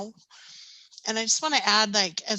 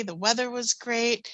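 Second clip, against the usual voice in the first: ordinary talk, nothing marked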